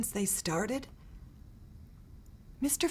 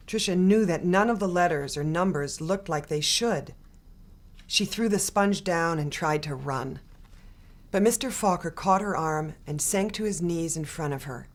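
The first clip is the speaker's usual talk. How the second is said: usual speaking voice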